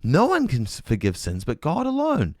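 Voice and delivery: a touch of outraged religious leaders; mock scandalized leaders. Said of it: "They're being".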